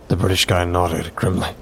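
ordinary delivery, nothing marked

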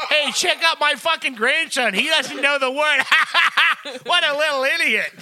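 mocking grandparent. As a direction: show off the grandson with laughing scorn. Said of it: "They'd be like".

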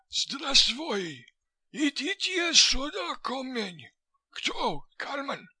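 high-pitched wheezing fat man; wheezy Russian. A narrator's reading. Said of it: "was answered wheezily from above".

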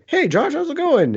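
playful high-pitched impression of a friend saying hi; imitating a cheery greeting. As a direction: stretch out a bright friendly greeting. Imitating Jimmy greeting him.

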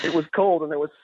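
Southwestern twang, starting over; Southwestern accent. Pretend. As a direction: rural Southwest reading voice. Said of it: "Southwestern accent".

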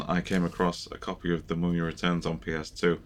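ordinary talk, nothing marked

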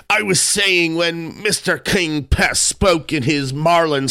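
high character voice, easing down and leaping up; brash sailor voice. A narrator's reading. Tarpaulin refuses to honour the king, brash sailor voice.

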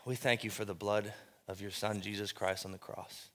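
low, soft, reverent prayer. Acted. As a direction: pray it quietly and low.

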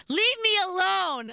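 high, loud mock scream; retold scream. Drunk girl screaming at friend over chips, mock shriek. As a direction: shriek it back at him, slowly. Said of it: "I screamed at him".

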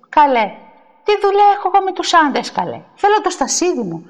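quick, high-pitched protest; indignant retort. Acted. Woman refusing to go to the men, indignant. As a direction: fire back crossly, then insist.

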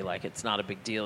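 usual speaking voice

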